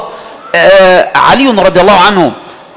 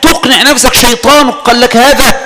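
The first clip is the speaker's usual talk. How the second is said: usual speaking voice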